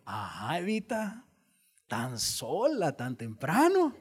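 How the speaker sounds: tentador burlón, lento en tan sola; insinúa con malicia a Eva; Satanás seductor. Acted. (Satanás seduciendo a Eva, burlón)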